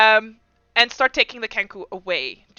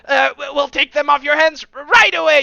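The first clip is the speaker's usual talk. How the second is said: loud, eager, flustered guard captain; fawning officer; 4 semitones higher, 6 dB louder than usual. Acted. Officer greeting the lord, loud and flustered. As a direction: grovel before the lord, stumbling.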